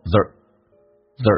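"the" as one reduced r-colored syllable; "the" with a murmured r-colored vowel; weakened "there are". Shown as a demonstration. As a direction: say "the" as a quick slurred "there are", tongue curled. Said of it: "口语化当中一个弱化现象".

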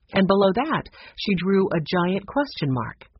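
plain reading voice

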